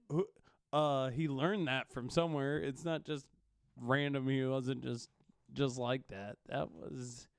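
normal talking voice

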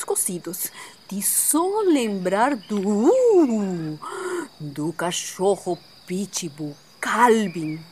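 slow spooky whisper. Acted. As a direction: whisper it like a scary secret. Scary storytelling about Calvin.

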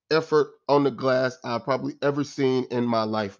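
ordinary talk, nothing marked

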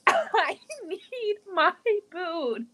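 put-on slow, high whining toddler; drawn-out wail. Daughter wailing for her boot, slow high whine. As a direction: stretch it out like a sobbing toddler.

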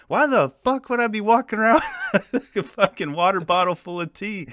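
high, loud imitation of an exasperated buddy; incredulous retort. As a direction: shout the question in comic disbelief. Buddy's retort in retold quarrel.

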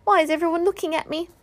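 high, small worried voice; a tiny pig feeling everyone's eyes; high nervous plea; anxious squeaky Piglet. A narrator's reading.

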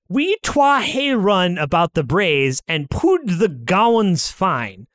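slow, deliberate American reading of Scots; American accent, drawn out word by word; most American English. Shown as a demonstration. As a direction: read the Scots words in flat-out American English. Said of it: "read this in the most American English".

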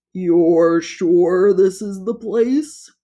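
nervous toad. A narrator's reading. Toad asks the rooster creature, slow anxious creature voice. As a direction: drag it out, anxious monster.

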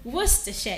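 Worcestershire said WUS-tuh-sheh; Worcestershire in three syllables, WUS-tuh-sher; correct pronunciation. Shown as a demonstration. Diction host modelling the word, WUS-tuh-sher. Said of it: "it is pronounced as".

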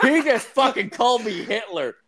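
laughing disbelief. Retold or imitated. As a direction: crack up in shocked disbelief. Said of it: "I was like".

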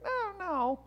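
very high, drawn-out imitated no; reluctant polite no. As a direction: mimic a vet gently sparing the owner's feelings. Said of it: "That was the no that he gives".

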